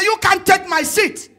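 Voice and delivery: loud high snap back, half laughing; retold angry retort. Arguing with the agent over her business seat, a loud angry retort.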